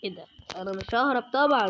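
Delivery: ordinary delivery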